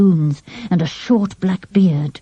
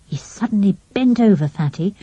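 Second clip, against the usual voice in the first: plain reading voice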